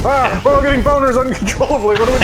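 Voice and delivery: high, panicky mock outcry. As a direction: squeal it like a room in a panic. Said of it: "It's like a weird problem".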